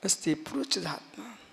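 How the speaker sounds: soft recitation of a sacred line; meditative refrain. Shown as a demonstration. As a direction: let the words settle quietly for meditation. Leading the meditation refrain softly. (ध्यान में मंद स्वर)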